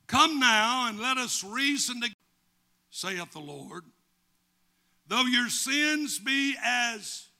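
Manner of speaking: loud, high scripture proclamation; forceful preaching. Real, not acted. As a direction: proclaim the verse to the congregation.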